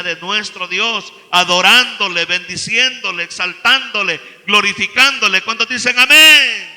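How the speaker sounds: loud, rising pulpit fervour; preacher stirring the congregation to praise; preaching. Acted. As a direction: build the praise, then call for amén. Preaching building to amén.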